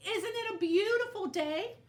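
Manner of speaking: high, bright and loud calling out; a sunny little voice calling across the desert; cheery tumbleweed voice. A narrator's reading. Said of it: "Rosie the tumbleweed called out".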